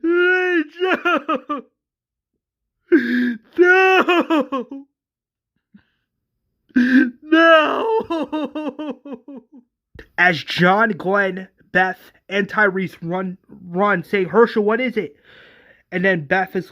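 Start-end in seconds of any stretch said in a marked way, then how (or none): 0.0-9.4 high, drawn-out quavering wail; hammed-up grief, howl it out; long cry dissolving into shaking sobs. Acted father wailing over daughter. 14.3-15.2 higher, urgent acted question; alarmed question. Acted friends running up alarmed.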